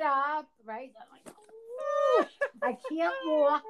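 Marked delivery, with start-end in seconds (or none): 1.3-2.3 high-pitched "ooh" of effort; imitated effortful groan. Mother gets up after hours sitting, mock groan. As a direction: groan in a high voice, acting out stiffness.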